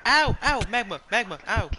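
high loud yelps of pain. Real, not acted. Gamer stepping in magma, yelping ow. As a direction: yelp as the magma burns.

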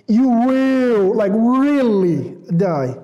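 really stretched out slowly; emphatic. Shown as a demonstration. Preacher explaining God's emphatic threat, slow stress on really. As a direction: lean hard and slow on really. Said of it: "a very emphatic manner of saying".

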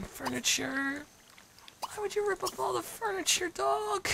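high squeaky scolding; mock scold. Player scolding the dog, high squeaky voice.

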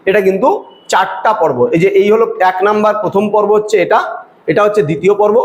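ordinary talk, nothing marked

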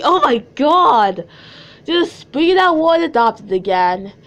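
loud, high, drawn-out complaint; slow, high, over-the-top indignation; exasperated outburst. Real, not acted. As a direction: cry out in exasperation, stretching the words. Exasperated reaction to the story's wording.

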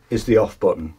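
ordinary delivery

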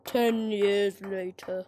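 low, slow announcer voice; deep narrator voice. Pretend. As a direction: announce it like a movie trailer. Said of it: "narrator caption voice".

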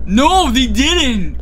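high, drawn-out disbelief; incredulous protest. Real, not acted. Player disputing teammate's excuse, drawn-out incredulous protest.

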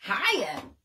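chirpy greeting. A narrator's reading. Bird chirping hello to sleepy friends. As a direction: pop it out cheerfully. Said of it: "chirped Bird".

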